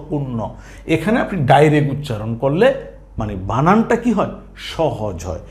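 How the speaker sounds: usual speaking voice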